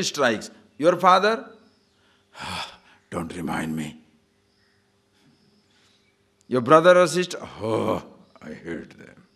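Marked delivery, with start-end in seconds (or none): 2.3-4.0 tired of hearing about him; weary sigh. Acted. Disciple voiced, weary of father. 7.6-9.2 slow, soft, lower, sour; fed-up disgust. Acted. Disciple voiced about siblings.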